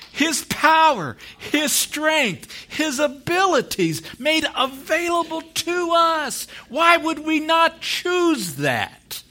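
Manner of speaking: high, loud, slow preaching climax; impassioned preaching. Real, not acted. Preacher urging the church to choose Christ's power, impassioned shout. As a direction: proclaim each phrase at full force, stretched out.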